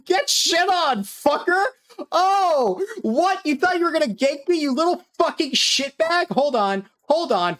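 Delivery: high, loud gloating shout mid-fight; triumphant yelling. Real, not acted. Gamer screaming at a player who tried to gank him, gloating. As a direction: yell down at the beaten attacker.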